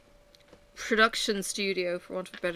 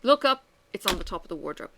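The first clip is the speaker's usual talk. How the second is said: ordinary talk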